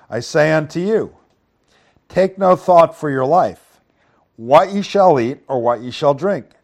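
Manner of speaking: loud, projected Bible reading; emphatic preacher reading aloud; scripture reading. Acted. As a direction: read the verse out to the congregation, firmly stressed.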